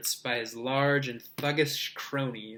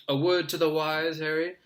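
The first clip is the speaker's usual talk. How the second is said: lightly paternal advice; a hint of fatherly condescension; 3 semitones higher, 4 dB louder than usual. A narrator's reading. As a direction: take him aside with a knowing word. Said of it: "said Lockhart paternally".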